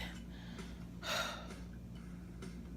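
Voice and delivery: long weary exhale; heavy sigh. Real, not acted. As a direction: let out a tired sigh. Tired mother telling birth story.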